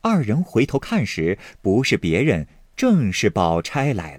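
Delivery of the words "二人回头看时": usual speaking voice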